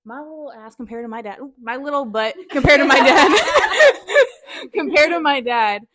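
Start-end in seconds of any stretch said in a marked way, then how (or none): 1.4-5.8 giggling through the words; cracking up mid-sentence; laughing. Real, not acted.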